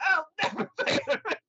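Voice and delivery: very high, laughing refusal; defiant laughing refusal. Retold or imitated. Tenant refusing the landlord, laughing high. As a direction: squeal it out, breaking into laughter.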